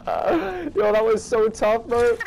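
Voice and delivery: high, loud, drawn-out awe; thrilled exclamation. Real, not acted. Gamer marvels at his own play, thrilled.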